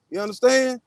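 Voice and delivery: usual speaking voice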